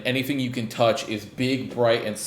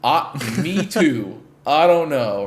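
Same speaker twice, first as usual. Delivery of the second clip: 1 baffled; 6 semitones higher, 6 dB louder, slower than usual. Retold or imitated. Player answering Bourbon, baffled.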